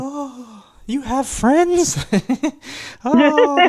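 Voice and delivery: high, drawn-out sarcastic gasp; overdone sarcastic astonishment; mock amazement. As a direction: fake shock at the thought.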